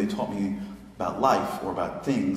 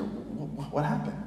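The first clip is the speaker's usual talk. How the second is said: much softer, stammering gently; hesitant interviewer; 4 semitones higher, 9 dB quieter than usual. Retold or imitated. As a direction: ask it tenderly, barely daring. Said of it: "the interviewer says to her".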